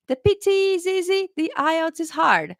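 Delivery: high, mock-naive voice imitating people; mocking quote. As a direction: parody what people wrongly say. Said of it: "when people say".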